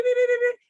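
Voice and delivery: high, rapid alarm beeps; imitated car alarm. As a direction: mimic a blaring car alarm. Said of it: "make all this noise".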